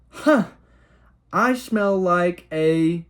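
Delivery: put-on high, slow, innocent child's voice; innocent kid voice. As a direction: blurt it out like a clueless little kid, drawn out. Kid blurting on the dock.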